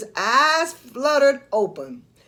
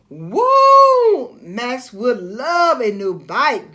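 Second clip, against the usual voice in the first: excited cry; 7 dB louder, slower than usual. Acted. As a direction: whoop with delight. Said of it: "Goofy cried".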